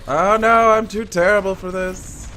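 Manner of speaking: high, loud wail of self-reproach; loud, high-pitched "I'm hopeless" outburst; dismayed wail. Real, not acted. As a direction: cry out in playful despair. Failing in the game.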